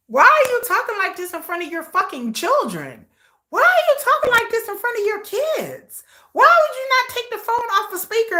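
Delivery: high-pitched indignant scolding; real outrage. Real, not acted. Scolding parents for that talk in front of kids, outraged. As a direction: let your blood pressure rise as you demand an answer. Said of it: "my blood pressure wasn't gonna get up".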